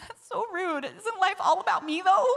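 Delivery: high, hurt little complaint; mock whining. Speaker complaining to God, mock whining. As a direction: play up the self-centred pout.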